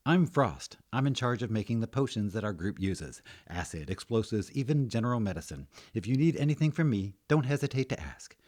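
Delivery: lightly voiced helpful woman; friendly potion maker. Guild's potion maker introduces herself.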